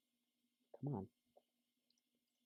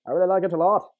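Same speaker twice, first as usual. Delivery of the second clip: put-on higher, louder old-Hollywood transatlantic; transatlantic accent; 4 semitones higher, 5 dB louder, faster than usual. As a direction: toast like a 1920s socialite. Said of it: "they all talk with transatlantic voices".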